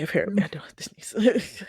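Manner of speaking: soft unvoiced aside; whisper. Real, not acted. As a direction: whisper it to yourself. Aside about a stuck sneeze.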